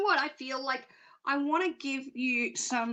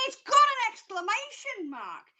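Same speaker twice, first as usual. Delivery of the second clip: excited; 9 semitones higher than usual. Real, not acted. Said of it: "I get really excited".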